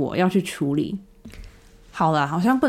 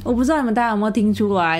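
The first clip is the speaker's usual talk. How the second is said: ordinary delivery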